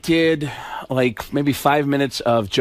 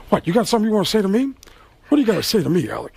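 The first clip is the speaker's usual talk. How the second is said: Al Pacino; 3 semitones higher, faster than usual. Acted. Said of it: "And he says".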